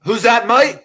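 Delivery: high, loud, cartoonish British "mate" voice; mock British accent. The British actor mocked, puzzled by the Green Arrow offer, in a hammed-up British accent.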